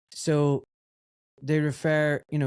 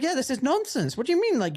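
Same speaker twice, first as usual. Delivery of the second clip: high, disbelieving objection; exasperated challenge in a raised voice; retold exasperated protest; 12 semitones higher than usual. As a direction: re-enact pushing back, pitch jumping up.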